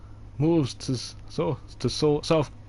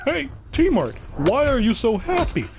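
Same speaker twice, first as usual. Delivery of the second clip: asking roommate; 11 semitones higher than usual. Retold or imitated. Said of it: "And you're like".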